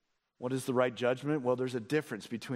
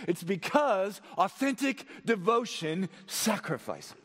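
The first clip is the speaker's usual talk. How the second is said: higher-pitched, urgent sermon delivery; impassioned preaching; 10 semitones higher, 3 dB louder than usual. Real, not acted. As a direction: press the congregation with rising conviction. Preaches at full force.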